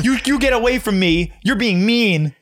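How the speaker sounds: high, whiny scolding voice; a timid kid telling off a bear; scaring off a bear. Pretend. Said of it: "yell at the bear in a loud, firm voice".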